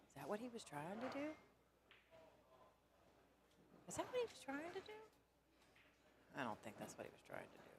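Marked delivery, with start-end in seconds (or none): 0.1-5.0 squeaky, much higher, disbelieving question; high mock-incredulous squeak rising at the end; mock disbelief. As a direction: pitch it way up like you can't believe the shot. Baffled at a puzzling shot.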